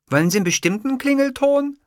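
high-pitched secretary voice. A narrator's reading. Secretary asking her boss about a ringtone, high character voice.

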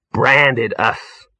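falter, then spit out the last words resentfully; uneasy, bitter realisation. A narrator's reading. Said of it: "said Ginger bitterly".

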